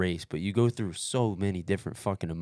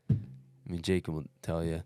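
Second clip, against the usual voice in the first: ordinary talk, nothing marked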